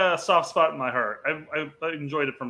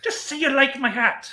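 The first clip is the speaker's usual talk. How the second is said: hammed-up Irish accent pitched well higher; bad Irish accent; 9 semitones higher than usual. Repeating the movie line in a terrible Irish accent. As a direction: overdo a clumsy Irish brogue. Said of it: "one of the worst accents I've ever heard".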